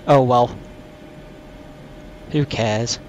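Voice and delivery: low, dismissive put-on voice; deep joke voice. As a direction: drop into a deep silly voice.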